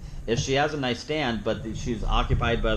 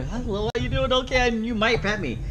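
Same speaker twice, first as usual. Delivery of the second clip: higher, bouncy voice of a happy dog greeting a judge; eager dog's voice; 9 semitones higher than usual. Acted. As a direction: play the dog who thinks it's getting petted. Trainer voices the dog's eager thoughts.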